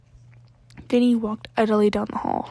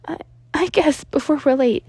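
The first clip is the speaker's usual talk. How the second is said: put-on higher, hesitant stammering boy; nervous boy; 5 semitones higher, 6 dB louder than usual. A narrator's reading. Midoriya nervous.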